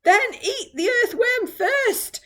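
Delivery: high, loud, slow shout. Acted. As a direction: shout it, pushing the blame away. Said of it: "shouted the centipede".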